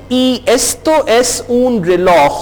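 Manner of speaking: Y esto es un reloj read slowly and evenly; a slow, careful classroom reading; slow model sentence. Shown as a demonstration. Teacher slowly reads the model sentence aloud. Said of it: "teacher repeats model sentence".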